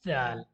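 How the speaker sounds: जाल drawn out as zaal; Arabic letter name. Shown as a demonstration. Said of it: "इसी तरीके से आपको अदा करना है".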